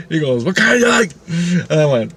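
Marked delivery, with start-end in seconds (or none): 0.4-1.1 high, pushy vendor question; acted-out high salesman voice; ice cream man. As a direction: call it out high to a customer. Acts out helicopter ice cream man.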